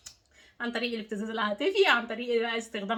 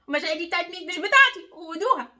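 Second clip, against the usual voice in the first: higher and louder, pushing the feelings back; forceful handing back; 5 semitones higher, 5 dB louder than usual. Shown as a demonstration. Coach showing viewer how to refuse guilt, loud and firm. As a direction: say it like you mean it, throwing them back. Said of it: "عايزاكي ترجعي لكل واحد مشاعره".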